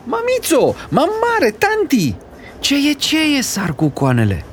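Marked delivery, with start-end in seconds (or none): 0.0-3.4 put-on very high wailing child calling his family; scared boy bawling for his mother. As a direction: howl for mama and auntie. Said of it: "începu să zbiere".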